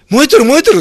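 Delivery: 高めで大きく、火事を知らせる人をまねて; 通りの人の「燃えてる」騒ぎをまねる; 叫びの再現. Retold or imitated. 火事を見た人々の叫びを再現. (火事に気づいた人の声を再現)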